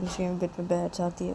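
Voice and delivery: usual speaking voice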